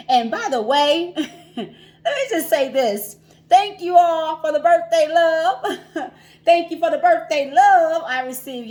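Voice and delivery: loud, high, drawn-out thank-you with laughs; super excited. Real, not acted. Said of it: "I'm, like, super excited".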